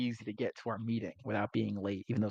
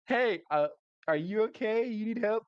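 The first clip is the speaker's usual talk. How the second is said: lightly voiced Aaron; 10 semitones higher than usual. Aaron voiced talking to woman.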